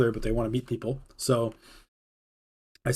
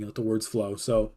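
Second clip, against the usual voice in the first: ordinary talk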